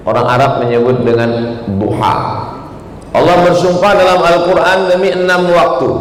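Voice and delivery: ordinary talk, nothing marked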